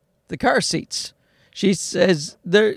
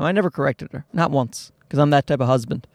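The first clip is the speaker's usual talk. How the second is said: normal talking voice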